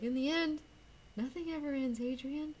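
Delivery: high, airy mock-mystical voice; airy parting words. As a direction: float the line out like a detached farewell. Acting John's parting line to Adrian.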